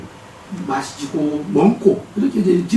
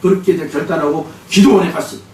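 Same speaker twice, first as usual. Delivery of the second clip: normal talking voice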